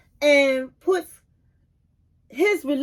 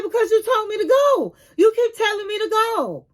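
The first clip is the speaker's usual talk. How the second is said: hurt protest; 7 semitones higher than usual. Retold or imitated. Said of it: "He said".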